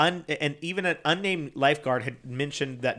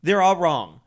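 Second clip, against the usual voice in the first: stubborn owner; 4 semitones higher, 5 dB louder, slower than usual. Retold or imitated. Quoting the stubborn park owner.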